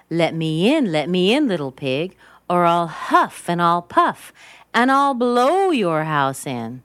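loud, emphatic wolf threat; storybook wolf demanding entry; big bad wolf. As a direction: call out and punch "huff" and "puff".

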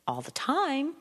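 "time" held high like a question; rising intonation. Shown as a demonstration. Teacher mimicking constant uptalk, rising on "time". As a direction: pitch jumps up and stays up through "time". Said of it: "I wouldn't have a rising conversation".

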